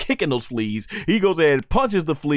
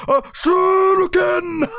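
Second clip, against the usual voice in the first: much higher, slow shouted fighting-game move call; Street Fighter special move call; 4 dB louder, slower than usual. Retold or imitated. Vegeta's uppercut called out like a Street Fighter move, shouted. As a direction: yell it like a fighting-game attack. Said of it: "for you, uh, Street Fighter fans out there".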